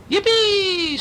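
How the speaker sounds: very high squeal of joy. A narrator's reading. Little monster thrilled to be a cowboy, squealing. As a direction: squeal it out with delight. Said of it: "Squeal squealed".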